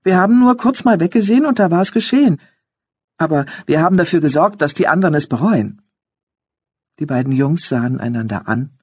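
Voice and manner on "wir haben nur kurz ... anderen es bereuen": higher, louder agitated youth; teenage boy voice. A narrator's reading. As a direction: report it like an upset teen. Older son voiced.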